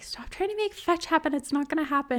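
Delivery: mock Regina, high and dismissive. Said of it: "Regina is like".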